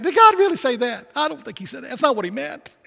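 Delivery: high, sly tempter voice of Satan; a high wheedling Satan voice, sowing doubt; Satan tempting. Acted. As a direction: coax like the serpent in the garden. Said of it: "Satan comes".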